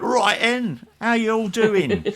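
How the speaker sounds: high, loud mock Cockney greeting; breezy London geezer hello, hammed up; imitating a cheery Cockney presenter; Cockney accent. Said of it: "spoke in his normal accent".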